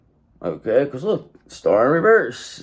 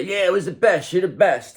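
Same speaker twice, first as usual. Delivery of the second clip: higher, hammed-up gushing reply; mock gushing praise; 8 semitones higher than usual. Shown as a demonstration. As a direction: overdo the praise as a joke. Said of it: "That's what you're supposed to say".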